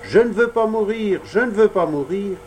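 high pleading cry; frightened puppet crying not to die. Acted. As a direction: beg for your life, voice up high. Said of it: "et crier".

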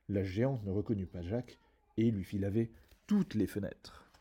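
usual speaking voice

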